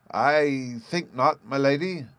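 slow, hesitant refusal, pitch up; a timid, drawn-out no. A narrator's reading. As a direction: hold back, unsure how to say no. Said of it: "the professor said with some hesitation".

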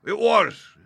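very high, loud confirmation; excited cry. A narrator's reading. Landlord confirming the blind man's name, excited. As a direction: burst out, quite excited. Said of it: "cried Silver".

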